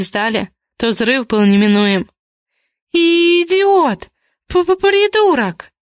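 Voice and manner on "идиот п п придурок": high, drawn-out stuttering insults; scolding outburst. A narrator's reading. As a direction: explode in exasperation, stretching each insult. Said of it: "ругалась Зоя".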